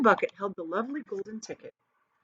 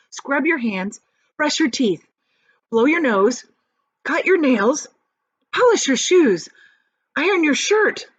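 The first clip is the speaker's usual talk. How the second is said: excited; 6 semitones higher, 6 dB louder than usual. Acted. Grandpa Joe rattling off orders.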